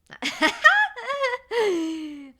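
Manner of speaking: big burst of high laughter; a loud, high laugh. Real, not acted. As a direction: crack up at your own joke. Joke about a million-dollar tip.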